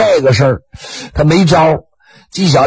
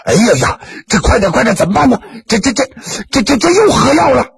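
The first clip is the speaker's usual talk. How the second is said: higher, frantic stammering alarm; panicked crying out; 7 semitones higher than usual. Acted. Neighbours inside the house panicking, frantic and high. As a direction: cry out in panic, stumbling over words.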